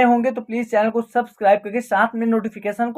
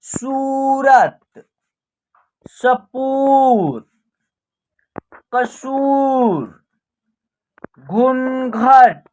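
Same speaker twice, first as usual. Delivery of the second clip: slow, stretched syllables on a level pitch; drawn-out word drill; much slower than usual. Shown as a demonstration. Teacher reading out a Hindi word list for practice, drawn out. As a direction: read each word slowly, holding the long vowels.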